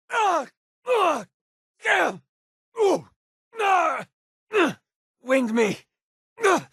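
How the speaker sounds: sharp high grunts of being hit; pained grunts. Acted. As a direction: grunt as bullets hit you. Companion pain grunts in combat.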